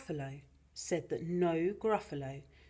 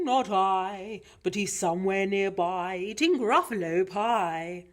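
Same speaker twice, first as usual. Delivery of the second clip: loud hooty bird voice; owl; 3 semitones higher, 8 dB louder than usual. Acted. Owl answers the child.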